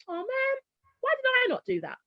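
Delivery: high-pitched retold regret; mock dismay. Mother seeing other parents show off homework, high dismayed self-reproach. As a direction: lift it right up, kicking yourself. Acting out her old regretful thought.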